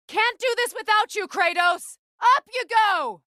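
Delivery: high, loud alarm calls; battle warnings shouted. Acted. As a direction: warn your ally over the noise of the fight. Calling ally mid-fight.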